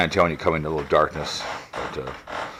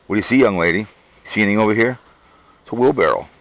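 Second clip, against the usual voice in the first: lightly raised, louder pet-talk voice; talking to the dog; 5 semitones higher, 5 dB louder, faster than usual. Real, not acted. Owner calling out to his dog, Chloe, in pet-talk. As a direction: coax the dog along, higher and louder.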